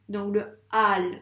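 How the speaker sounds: le al étiré lentement; étirer al; al drawn out. Shown as a demonstration. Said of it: "deux lettres à écrire". (son al étiré et appuyé)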